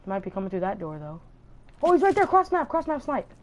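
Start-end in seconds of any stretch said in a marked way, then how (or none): 1.8-3.3 high, loud urgent callout; excited callout. Real, not acted. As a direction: yell the warning to the team. Callouts during a firefight.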